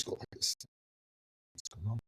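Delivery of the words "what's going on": very soft inner question; puzzled aside. Retold or imitated. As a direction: let it slip out under your voice. Wondering to himself behind her.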